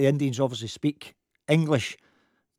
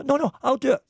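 mock insisting; 10 semitones higher than usual. Sandy insisting.

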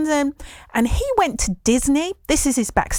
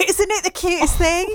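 laughing; 9 semitones higher than usual. Real, not acted. Gushing over cute child clip.

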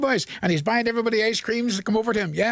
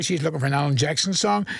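ordinary talk

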